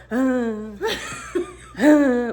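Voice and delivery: short breathy effortful "ah"; wheezing. Retold or imitated. Man carrying woman uphill, imitated short wheezes. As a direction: puff out one strained "ah" per step. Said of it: "I hear him wheezing".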